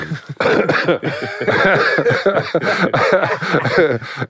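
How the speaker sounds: high laugh. Real, not acted. Laughing about his wife.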